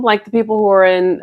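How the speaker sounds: ordinary talk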